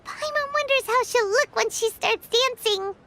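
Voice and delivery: ordinary talk, nothing marked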